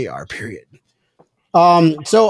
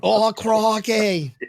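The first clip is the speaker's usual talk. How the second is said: slow, drawn-out mock Australian; long-vowelled Australian impression; Australian accent; 3 semitones higher, much slower than usual. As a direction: stretch it in a put-on Aussie accent. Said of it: "better than the Australian accent".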